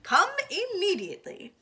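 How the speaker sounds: loud, clipped official phone report; brisk emergency call. Acted. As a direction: report it firmly, in charge. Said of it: "said Eugenia Lincoln".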